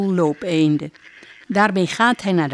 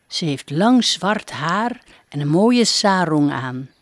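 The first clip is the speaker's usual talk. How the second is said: usual speaking voice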